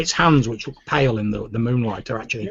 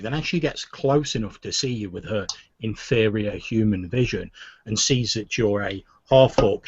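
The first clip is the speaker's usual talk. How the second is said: normal talking voice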